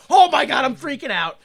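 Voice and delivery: normal talking voice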